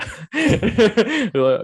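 high, loud laugh bursting out; big high laugh; high burst of laughter. Real, not acted. Laughing at dirty secrets joke.

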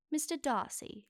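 British accent. Shown as a demonstration. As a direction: say it as a British period-drama line. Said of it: "that's nailing it".

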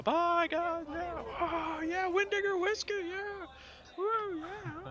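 high whooping send-off; cheering crew. Acted. Crew waving the adventurers off, high cheering. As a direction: call it out like a rowdy crew. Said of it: "the rest of the crew all".

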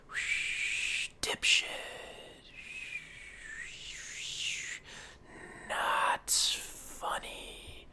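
eerie unvoiced whisper from the mic; slow, creepy whispered heckle. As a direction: breathe it out like a ghostly heckler. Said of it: "I'll just hear whispers from the mic".

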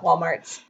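Walmart's with an added S on the end; Southern "Walmart's". Shown as a demonstration. Southerner showing the local name, "Walmart's" with final S. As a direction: say "Walmart's", ending in an S. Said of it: "If you're from the South".

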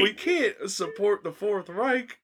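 high, slow, giggling no's; laughing protest. Real, not acted. Refusing his friend's toast, laughing helplessly. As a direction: protest while you can't stop laughing.

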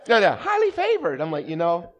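ordinary talk, nothing marked

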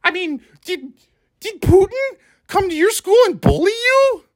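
very high mocking pundit squeal; squeaky Tucker Carlson. Podcaster parodying a TV host defending Putin, high squeak. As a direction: keep it squeaky and incredulous throughout. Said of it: "sound like Tucker Carlson".

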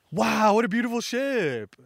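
high, hammed-up wonder; acted amazement. Buggy seeing his new ship, high mock-awed exclamation. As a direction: marvel loudly at the sight.

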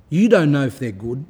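preaching loudly. Real, not acted. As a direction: raise the voice to preach. Preaching at full force.

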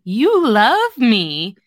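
very slow, singled-out words; teasing. Acted. He teases her about her feelings, teasing. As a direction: stretch it right out to tease her. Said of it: "teasing her".

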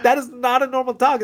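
squeaky outraged crew voice; high mock voice. Podcast host quoting the crew about the dog, high and mock-outraged. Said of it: "they're like".

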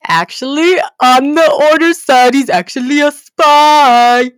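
loud, high thrilled reveal; spring the twist with glee; gleeful reveal. Real, not acted. Revealing Draco's secret to viewers, loud and gleeful.

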